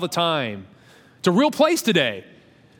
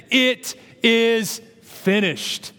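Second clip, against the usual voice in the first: solemn slow; 5 semitones higher, much slower than usual. Acted. Jesus's last words on cross.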